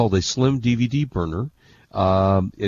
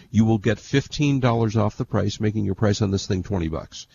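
ordinary delivery

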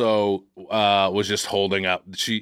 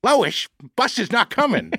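higher, slow Peter Griffin voice; 10 semitones higher than usual. Retold or imitated. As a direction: do Peter Griffin talking to Lois. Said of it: "I sound like Peter Griffin".

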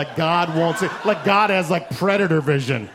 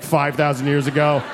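usual speaking voice

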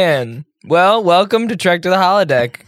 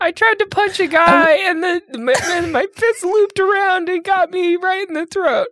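fake Jan voice; 12 semitones higher than usual. Cohost fakes absent Jan's voice for a joke, high put-on voice. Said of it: "Jan, you don't sound like that".

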